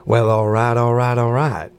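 lazy Texas drawl of McConaughey; all right said in a slow Texas drawl. Retold or imitated. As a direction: Texas drawl done for fun. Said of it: "Matthew McConaughey loves to tell us".

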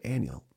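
Annual said as AN-yul; regional annual. Shown as a demonstration. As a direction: squeeze Annual into two syllables, AN-yul. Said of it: "we say annual".

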